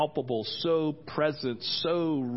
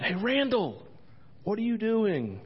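higher-pitched call to someone; 7 semitones higher than usual. Retold or imitated. Pastor retelling a call to Randall, calling out. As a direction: imitate hailing someone in another room.

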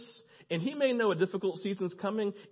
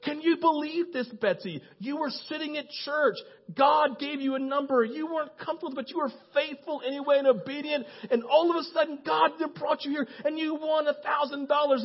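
high-pitched amazed retelling; excited, high-voiced host; Cadillac; 7 semitones higher than usual. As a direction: imitate a radio host marvelling at the winner. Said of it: "Cadillac again goes".

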